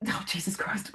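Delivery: whispered, dreading aside; muttered dismay. Real, not acted. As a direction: mutter it under your breath. Nervous aside before attempting impression.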